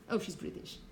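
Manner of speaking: breathy whispered aside mimicking an American; quietly mock an American's remark; imitating Americans. Americans commenting on Hepburn, mock whispered aside. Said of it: "Americans said that she sounded so too British".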